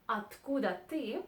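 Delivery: usual speaking voice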